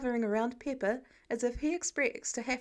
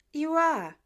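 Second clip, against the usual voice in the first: in unison; 5 semitones higher, 6 dB louder than usual. A narrator's reading. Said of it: "they say in unison".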